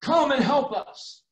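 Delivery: high, slow, drawn-out calling cry; a man in a vision calling Paul to come; commanding shout. Retold or imitated. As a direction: call out the plea like a command across distance. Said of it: "He's shouting and commanding".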